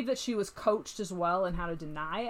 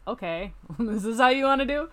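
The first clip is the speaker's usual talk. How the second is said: imitated challenge; 4 semitones higher, 5 dB louder than usual. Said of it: "And she was like".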